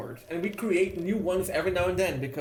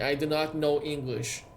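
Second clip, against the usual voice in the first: usual speaking voice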